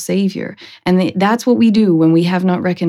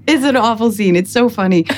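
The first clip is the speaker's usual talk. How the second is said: usual speaking voice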